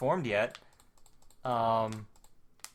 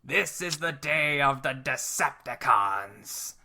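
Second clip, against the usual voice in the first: slow, drawn-out villain proclamation; put-on villain voice, dragging out each word; Megatron's proclamation; slower than usual. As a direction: declare it slowly like a cartoon overlord. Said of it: "shouts Megatron".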